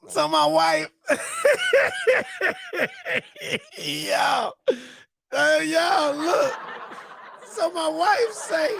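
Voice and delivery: high voice breaking up with laughter; can barely get it out for laughing; helpless real laughing fit; crying with laughter. Real, not acted. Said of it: "I'm crying".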